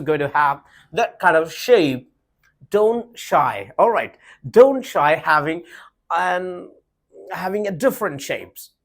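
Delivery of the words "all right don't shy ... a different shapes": ordinary delivery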